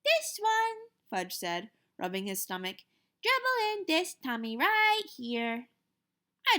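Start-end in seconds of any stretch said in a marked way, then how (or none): 0.0-0.9 put-on very high little-kid voice; lightly voiced toddler answering his brother. 3.2-5.7 put-on very high little-kid voice; lightly voiced toddler answering his brother.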